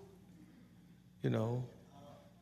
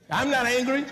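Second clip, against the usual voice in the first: louder, high-pitched mock protest; indignant denial; 9 semitones higher, 5 dB louder than usual. Angry person denying the obvious, mock indignant. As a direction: protest it loudly, as if offended.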